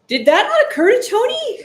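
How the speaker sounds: loud, high, exasperated; fed-up fan yelling at the game. Real, not acted. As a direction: shout it at the screen in disbelief. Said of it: "Oh, we're yelling".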